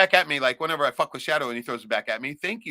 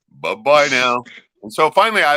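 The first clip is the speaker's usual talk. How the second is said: ordinary delivery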